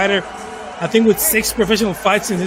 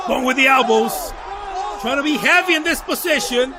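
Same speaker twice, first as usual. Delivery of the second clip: play-by-play; 4 semitones higher than usual. Real, not acted. Live play-by-play of a fight.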